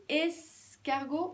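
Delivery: "escargot" with a long hissed es; first syllable isolated. Shown as a demonstration. Teacher pointing out the beginning sound, first syllable isolated. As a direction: hold es, pause, finish "escargot". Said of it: "on entend es".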